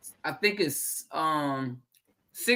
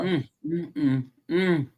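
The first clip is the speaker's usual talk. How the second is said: savoring hums; 2 semitones lower, 4 dB quieter, slower than usual. Real, not acted. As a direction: hum with pleasure over the taste. Tasting the sweet persimmon.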